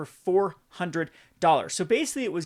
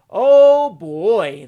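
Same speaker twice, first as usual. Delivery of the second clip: loud, high, exasperated exclamation; 5 semitones higher, 14 dB louder than usual. Real, not acted. Dreading the hyped set.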